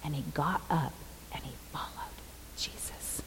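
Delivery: fading into a bare whisper; hushed, moved. Real, not acted. Preacher moved by the end of the healing story, hushed. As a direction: let the voice fall away to a whisper.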